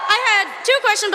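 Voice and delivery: ordinary delivery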